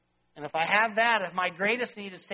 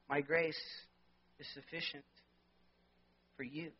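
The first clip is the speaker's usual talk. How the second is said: hushed assurance; 4 semitones lower, 9 dB quieter than usual. Acted. Quoting God's comfort quietly.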